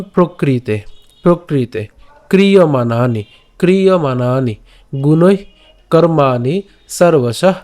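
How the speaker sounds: each word said twice, slowly and clearly; careful sloka drilling; word-by-word pronunciation. Shown as a demonstration. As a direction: break the sloka into words. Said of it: "উচ্চারণটা কেমন".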